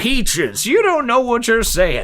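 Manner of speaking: high, loud grizzled prospector voice; old prospector. Retold or imitated. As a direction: play a cranky old gold miner. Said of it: "why I sound like an old prospector".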